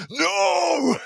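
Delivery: sudden very high, loud yell; imitated drunken shout. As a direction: mimic a tipsy man who has started shouting. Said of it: "gets louder and louder and starts shouting".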